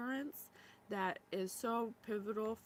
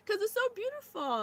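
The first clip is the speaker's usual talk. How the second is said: much higher, louder voice breaking with tears; crying; 10 semitones higher, 6 dB louder than usual. Real, not acted. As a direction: let it crack as you cry. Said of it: "Look, I'm crying".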